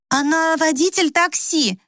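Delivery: high, bright cover-up; quick improvising. A narrator's reading. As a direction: jump in with a drawn-out, high-pitched invention. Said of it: "быстро нашлась Мила".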